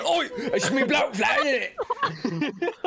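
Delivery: very high, laughing Cockney geezer; over-the-top Cockney with a laugh; Cockney accent. Pretend. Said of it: "in a Cockney accent".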